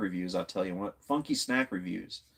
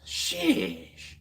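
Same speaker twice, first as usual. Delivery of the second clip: excited exclaim. Real, not acted. Still amazed by the chat.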